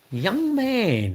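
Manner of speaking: put-on young man pitched high, falling off; mock high young man. Shown as a demonstration. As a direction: hit young man way up high then let it drop. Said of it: "not like that".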